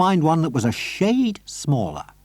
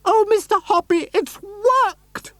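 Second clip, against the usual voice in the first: much higher, crying out with joy; high lady overjoyed; shrill excited lady; slower than usual. Acted. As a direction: squeal the good news. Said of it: "she was crying".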